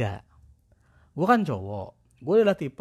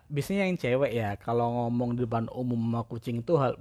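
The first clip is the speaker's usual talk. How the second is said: ordinary delivery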